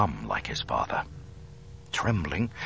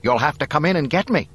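high, quick, loud challenge; Luke, defiant; 8 semitones higher, 6 dB louder, faster than usual. A narrator's reading. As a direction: call back defiantly from hiding. Hidden Luke answers Vader defiantly.

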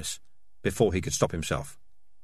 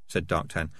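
ordinary delivery, nothing marked

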